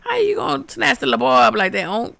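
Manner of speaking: high, loud, fast scolding through a laugh; laughing, high-pitched protest at the screen; indignant outcry. Retold or imitated. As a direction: scold the ghost like it did you wrong. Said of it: "I said".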